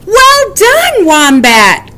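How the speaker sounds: high, loud cheer. A narrator's reading. Friends cheering Wombat after the wasp. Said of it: "cried Weasel and Woodchuck".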